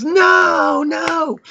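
a high, pained "no, no" in his friend's voice; mock despair at the wrong answer; exasperated friend. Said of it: "and he goes".

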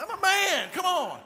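high, loud, hammed-up protest; mock indignant boast. Husband answers his doubting wife, mock indignant boast.

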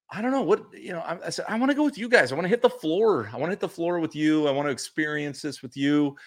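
high, eager retold plea; eager pleading. Fan begging his friends to take him along, high-pitched pleading.

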